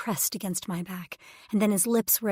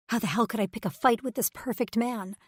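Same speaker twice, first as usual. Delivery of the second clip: ordinary delivery, nothing marked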